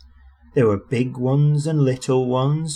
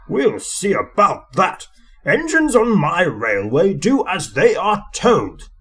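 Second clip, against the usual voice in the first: higher, louder, slow stern boss; stern Fat Controller; 7 semitones higher, 4 dB louder than usual. A narrator's reading. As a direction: lay down the law to the engines. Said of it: "said the Fat Controller severely".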